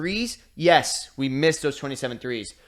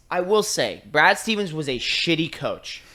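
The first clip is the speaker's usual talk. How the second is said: ordinary delivery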